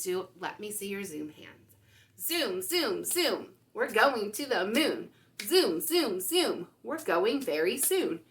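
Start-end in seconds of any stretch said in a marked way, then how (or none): none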